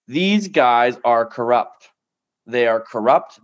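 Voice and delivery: "These guys are corrupt" slow, with "These" punched; "These" hit hard, "corrupt" lands firm; stress on these. Real, not acted. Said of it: "I wanna be very clear to everyone listening".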